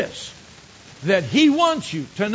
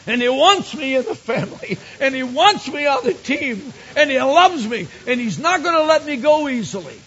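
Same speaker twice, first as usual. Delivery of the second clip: raised, ringing voice building in force; impassioned testimony; 6 semitones higher, 4 dB louder than usual. Retold or imitated. Preacher retelling his friend's testimony of being chosen, impassioned and rising. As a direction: proclaim it high and strong, phrase upon phrase.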